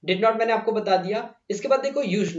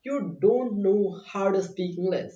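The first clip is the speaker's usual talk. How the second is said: slow, careful contracted form don't; slow contracted demo; much slower than usual. Shown as a demonstration. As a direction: say "don't" as one short word, slowly. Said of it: "मैंने short form use कर लिया". (धीमे, "don't" छोटा करके बोलो)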